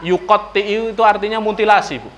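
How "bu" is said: ordinary delivery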